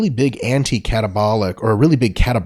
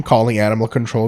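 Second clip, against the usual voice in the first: ordinary delivery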